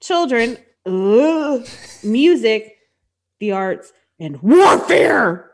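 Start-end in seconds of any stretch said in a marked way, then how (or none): 4.2-5.5 slow, loud, drawn-out punchline delivery; dramatic emphasis. Acted. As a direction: land the last word heavily and slowly. Listing goddess domains, dramatic finish.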